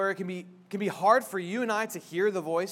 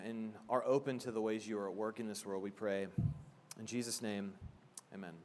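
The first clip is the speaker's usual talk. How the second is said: prayer; 5 semitones lower, 6 dB quieter than usual. Acted. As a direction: pray quietly to God, unhurried. Opening prayer before sermon.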